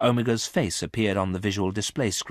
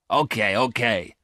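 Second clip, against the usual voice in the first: louder, slow, impatient concession; 3 semitones higher, 6 dB louder than usual. A narrator's reading. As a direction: give in with audible impatience. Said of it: "said Garth impatiently".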